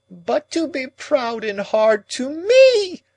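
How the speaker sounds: high, loud, slow shriek. A narrator's reading. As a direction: shriek it at her in anguish. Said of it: "Miss Havisham quite shrieked".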